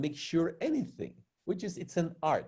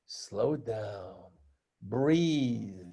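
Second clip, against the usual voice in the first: calm advice; 8 semitones lower, much slower than usual. Shown as a demonstration. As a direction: model the calm you want from her.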